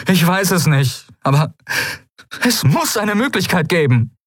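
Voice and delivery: high, agitated insistence; desperate. A narrator's reading. Kai at a loss before Ailan, desperate. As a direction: grasp for a solution, pitch right up.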